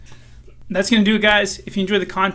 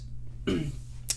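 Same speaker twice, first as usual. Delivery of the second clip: throat clearing; 10 dB quieter than usual. Real, not acted. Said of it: "Excuse me, guys".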